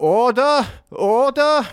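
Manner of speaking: very high, strained call; hammed-up high cry over a rowdy chamber; mock shouting for order. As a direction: imitate a speaker calling the house to order. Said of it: "hur mycket Lucien ropar".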